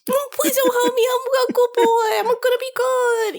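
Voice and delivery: put-on high, pleading, whimpering good boy; sniveling little baby. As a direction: beg like a scared puppy. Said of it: "sniveling little baby".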